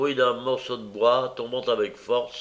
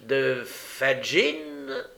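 questioning; 3 semitones higher, much slower than usual. A narrator's reading. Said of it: "dit Toby en considérant Olivier".

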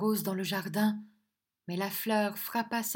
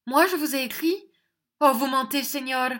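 high, loud indignant accusation; forceful outcry; 6 semitones higher, 8 dB louder than usual. A narrator's reading. Angèle accusing Fernand of lying, indignant outcry. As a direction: fling the word liar at him. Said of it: "s'écria Angèle avec force".

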